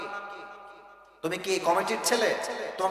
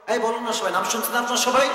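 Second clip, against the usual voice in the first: higher, louder repeat of the question; insistent, raised-pitch question to everyone; prodding the audience; 6 semitones higher, 3 dB louder, faster than usual. Real, not acted. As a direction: press the crowd for an answer. Prods silent audience to answer.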